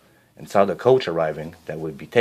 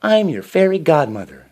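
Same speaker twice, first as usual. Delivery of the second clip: high, louder kindly godmother voice; kind fairy godmother; 9 semitones higher, 6 dB louder than usual. A narrator's reading. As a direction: greet her gently as a godmother. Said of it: "the lady said kindly".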